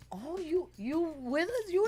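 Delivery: surprised dancers. Retold or imitated. Dancers surprised she joins.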